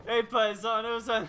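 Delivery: slow, loud hammed-up Italian accent greeting; loud mock Italian accent. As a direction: boom it like a cartoon Italian. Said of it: "every killer in this game does have an Italian accent".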